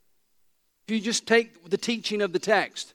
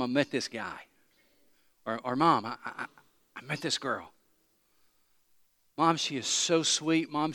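put-on smitten teenager; 4 semitones lower, 6 dB quieter than usual. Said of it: "how you went home to your mom".

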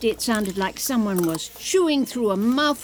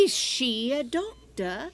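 normal talking voice

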